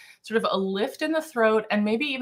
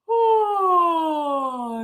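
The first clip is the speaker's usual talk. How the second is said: high yawning oh; yawn sound; 9 semitones higher, 3 dB louder than usual. Shown as a demonstration. Teacher showing a yawn on oh. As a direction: let a yawn open the throat. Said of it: "kinda feel what that feels like".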